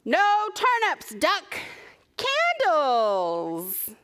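put-on high, loud, slow telling-off for kids; hammed-up storybook exasperation; exasperated correction. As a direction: overrule Duck with drawn-out emphasis. Friends correct Duck in the story.

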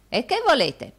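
high, loud brusque question; an old servant demanding what's wanted; deaf old housekeeper. A narrator's reading. Deaf housekeeper asks back.

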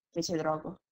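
usual speaking voice

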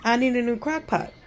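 usual speaking voice

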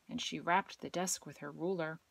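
usual speaking voice

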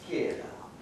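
hushed, almost whispered get up; still small voice. Acted. God to Elijah in the cave, a still small voice. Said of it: "a still small voice comes to him".

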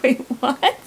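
a high, loud double take; stunned "wait, what". Retold or imitated. To her lying friend about the punch, loud and stunned. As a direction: thrown completely, retelling it. Reacting to punched-eye claim.

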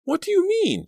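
very high, loud shriek of disbelief. A narrator's reading. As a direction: shriek it, shocked. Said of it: "Danielle shrieked".

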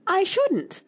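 high, loud, affronted outburst; indignant denial; indignant exclamation. A narrator's reading. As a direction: protest it sharply, pitch leaping up. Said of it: "Alice exclaimed indignantly".

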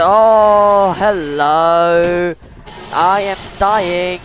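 loud, drawn-out wail; slow loud despairing cry. Real, not acted. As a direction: wail as you die. Getting killed in game.

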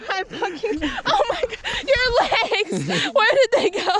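laughing hard while talking; words breaking into laughter. Real, not acted. Laughing at friend sunk in snow.

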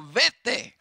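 high, loud banishing shout; forceful interpreter echoing a roar; roared command. Acted. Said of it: "ese rugido le dice al diablo".

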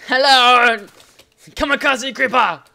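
loud high creeper voice. Retold or imitated. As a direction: shout like a suicidal creeper. Voicing a creeper.

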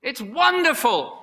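slow, high, loud exclamation; ecstatic joy. Acted. Preacher as Paul rejoicing in God's law, ecstatic. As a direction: cry it out, overwhelmed with delight. Climax of Paul's voiced delight.

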